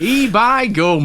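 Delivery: slow, hammed-up Yorkshire accent. Joins in the bad Yorkshire bit.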